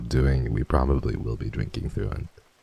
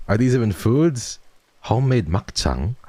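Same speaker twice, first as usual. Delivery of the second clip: ordinary delivery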